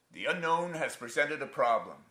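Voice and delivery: a touch of a pompous announcement to his son; father's pronouncement. Acted. Father's pronouncement, acted in story.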